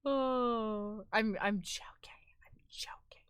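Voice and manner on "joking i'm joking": whispering. Real, not acted. Softened take-back after joke.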